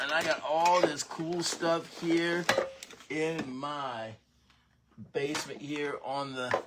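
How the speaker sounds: oh no, not my stuff; dismayed surprise. Real, not acted. After spilling water on his desk, loud dismayed disbelief.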